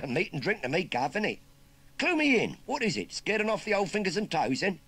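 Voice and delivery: high, loud cheeky Cockney lad; chirpy London street kid rattling off slang; perky Cockney urchin. Acted. Urchin asks farmer about the job in Cockney.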